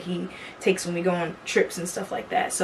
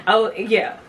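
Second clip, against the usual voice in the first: ordinary delivery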